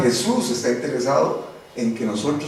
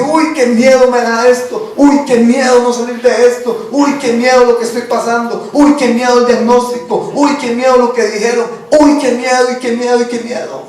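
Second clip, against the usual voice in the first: higher, louder hammed-up fretting; mock fearful whining; 6 semitones higher, 5 dB louder than usual. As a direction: whine each uy like a scared believer. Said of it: "cuando la gente ve el problema, dice".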